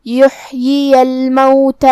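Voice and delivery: یحیی الموتی with the first ya on zer, the second on zabar; both ya clear. Shown as a demonstration. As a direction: chant یحیی slowly, both ya sounded. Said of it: "پہلے یا کو زیر سے پڑھیں گے اور دوسرے کو زبر سے". (دونوں یا ظاہر کر کے)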